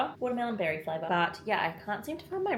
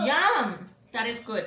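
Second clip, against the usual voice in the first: delighted yum, bursting out; loud happy taste reaction; excited; 5 semitones higher, 6 dB louder, slower than usual. Real, not acted. Tasting food just cooked.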